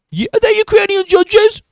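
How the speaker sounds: very high mock reporter voice. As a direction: squeal the question like a suck-up. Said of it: "Just a salty motherfucker".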